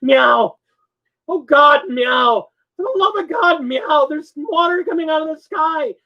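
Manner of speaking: high, loud panicked cat yowls; desperate cat. Retold or imitated. Roommate voices the cat pleading in the rain, high and loud. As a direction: play the cat begging to be saved. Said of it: "in his desperation starts getting louder".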